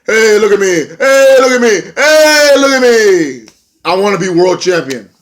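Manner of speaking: mock him begging for a title shot; mock attention-seeking yell. Vlogger mocking Mike Bennett's promo with a building whiny yell. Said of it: "he's pretty much like".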